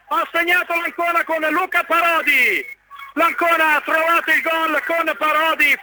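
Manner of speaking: high, excited goal shout; breathless italian goal commentary. Real, not acted. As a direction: scream the goal with joy. Radiocronaca, secondo gol. (grido del gol, acuto e concitato)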